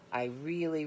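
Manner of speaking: ordinary delivery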